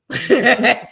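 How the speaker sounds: a burst of high, loud laughter. Real, not acted. Laughing at her younger self breaking stuff, loud laugh.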